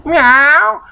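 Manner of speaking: a high playful meow; cat meow. Pretend. Reader playing a kitty for the kids, high meow.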